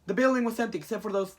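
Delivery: normal talking voice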